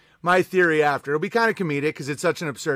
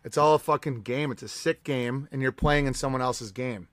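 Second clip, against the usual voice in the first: normal talking voice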